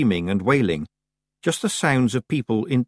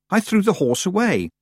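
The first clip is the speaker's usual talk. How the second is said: high-pitched protest at normal pace; 9 semitones higher than usual. A narrator's reading. Tiffany objecting to what the Wintersmith is doing, protesting. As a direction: protest it, voice raised in pitch, not shouted. First protest, before shouting it.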